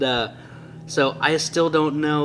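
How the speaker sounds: ordinary delivery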